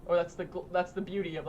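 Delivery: ordinary delivery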